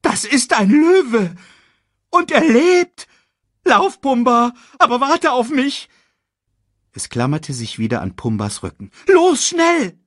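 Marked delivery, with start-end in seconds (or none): 0.0-5.9 hoch, laut und langsam erschrocken; panisches Erdmännchen. Acted. Timon erschrickt vor Löwe. 9.1-10.0 hoch, laut und langsam erschrocken; panisches Erdmännchen. Acted. Timon drängt Pumba.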